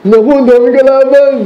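playing a soldier overwhelmed by foes; mock dismay. Soldier seeing the enemy flood, mock panic. Imagining facing many enemies.

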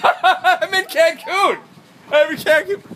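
very high, loud, gleeful yell; laugh, then holler it at full pitch; screaming. Acted. Vlogger yells out that he is in Cancun, screaming. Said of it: "screaming about Minecraft".

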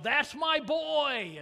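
slow, high proud shout of a father; proud yell. Retold or imitated. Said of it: "and yelled".

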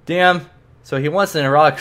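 ordinary delivery

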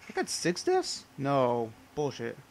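can't believe the number; shocked disbelief. Real, not acted. Sees death count, disbelief.

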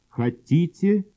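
normal talking voice

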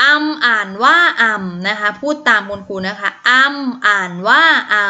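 อำ read slowly and clearly for pupils to repeat; teacher modelling อำ for the class; slow model reading. Shown as a demonstration.

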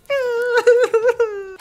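high, bright burst of laughter; embarrassed giggle; real laugh. Real, not acted. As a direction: laugh at your own slip. Laughs at her own slip.

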